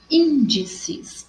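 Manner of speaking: "índices" sounded out with a high, stressed ÍN; teacher's demonstration of ÍN in "índices". Shown as a demonstration. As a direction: lean on the first syllable ÍN of "índices". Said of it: "o in é mais forte".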